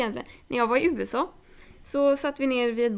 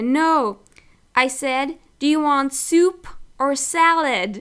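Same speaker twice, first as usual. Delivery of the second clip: waitress correcting slowly; 3 semitones higher, 6 dB louder, slower than usual. Retold or imitated.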